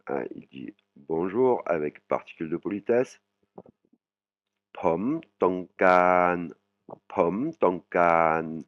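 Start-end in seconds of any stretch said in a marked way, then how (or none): 4.7-8.6 very slow, careful pom tongkan; slow Thai demo. Shown as a demonstration. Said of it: "Pom, c'est je". (pom tongkan très lentement articulé)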